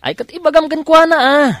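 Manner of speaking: sudden excited protest to a friend; excited outburst. Acted. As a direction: blurt it out in a rush. Excited outburst mid-conversation.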